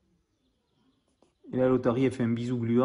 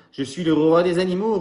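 louder, hammed-up boast; mock roaring; 3 semitones higher, 7 dB louder than usual. Alistair pretends to be a lion to scare baby koala, in a loud hammed-up voice. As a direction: roar it to scare the little one. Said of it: "rugit Alistair pour faire peur".

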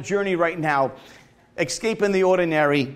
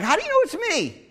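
much higher, amazed "how do you know"; mock surprise; 12 semitones higher, faster than usual. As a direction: retell the caller's baffled question with a jump in pitch. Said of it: "And I'm like".

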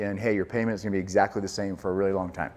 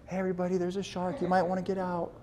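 softer, casual mock announcement; calm polite warning; 7 semitones higher, 8 dB quieter than usual. A swimmer calmly telling the beach about the shark, softer and offhand. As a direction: mention the shark like it's no big deal. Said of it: "Was it like".

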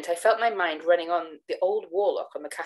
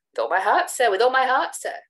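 eager assent; 6 semitones higher, 5 dB louder than usual. Acted. Eager agreement, rising.

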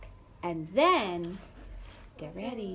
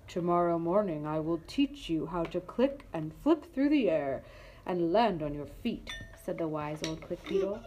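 put-on lower, calm old beetle voice; wise old beetle; 5 semitones lower, 3 dB quieter than usual. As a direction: speak like a patient old teacher.

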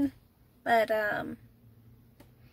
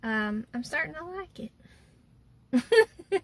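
reluctant; 4 semitones higher, 4 dB louder, much slower than usual. Real, not acted. Reluctant admission about rental car.

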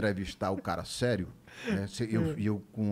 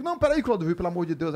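panicked pleading; 6 semitones higher, 6 dB louder, faster than usual. Retold or imitated. Host pleading during on-air fight.

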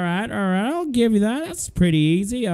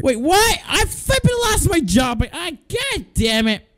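dismayed shout; 7 semitones higher than usual. Real, not acted. Shop worker learning he lost his job, dismayed yelling. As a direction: cry out at the unfairness. Just got fired in game.

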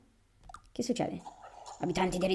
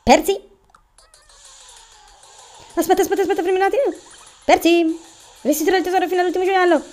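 high, loud desperate begging; panicked pleading; 8 semitones higher, 6 dB louder than usual. Acted. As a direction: beg for mercy in panic.

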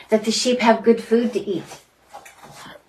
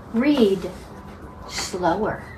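ordinary delivery

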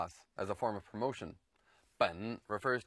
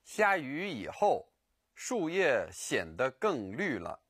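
slow; slower than usual. Shown as a demonstration. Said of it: "For example".

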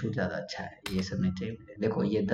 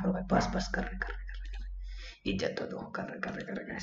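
whispering to himself; 11 dB quieter than usual. Real, not acted. Muttering to himself mid-match.

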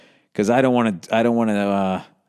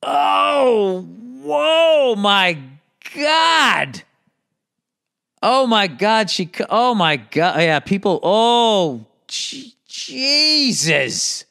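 shocked gasps; 11 semitones higher, much slower than usual. Real, not acted. Looking at gory injury photos, high horrified cries. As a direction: recoil in real horror at each picture.

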